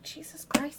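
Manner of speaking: unvoiced exasperated whisper; a hushed aside of disbelief; inner whisper. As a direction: mutter it to yourself in your head. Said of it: "In her head".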